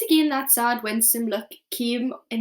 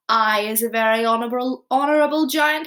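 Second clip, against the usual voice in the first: louder, proud declaration; proudly; 8 dB louder than usual. Acted. Giant answering Sophie proudly, louder. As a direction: puff up with pride. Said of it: "raised his great head proudly".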